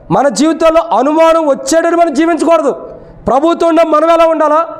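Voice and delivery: high-pitched, insistent sermon delivery; preaching. Real, not acted.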